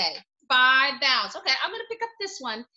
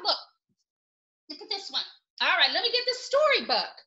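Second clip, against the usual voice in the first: normal talking voice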